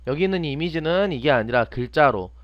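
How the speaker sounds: usual speaking voice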